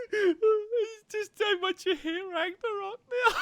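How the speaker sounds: real laughing fit, high and loud; helpless laughter. Real, not acted. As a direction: laugh so hard the apology breaks.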